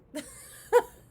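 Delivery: burst of laughter. Real, not acted. Laughing at the aunt bailing.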